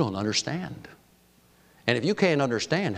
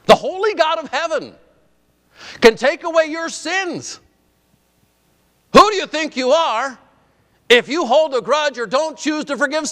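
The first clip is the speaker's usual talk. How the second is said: high, loud fervent preaching; rising revival preacher intensity; preaching at full force; 13 semitones higher, 5 dB louder than usual. Acted. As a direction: drive it home from the pulpit.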